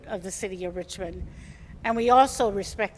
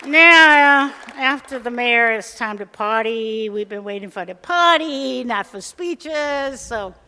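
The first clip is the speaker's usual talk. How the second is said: excited hype; 2 semitones higher, 9 dB louder than usual. Real, not acted. Hyping the crowd to party.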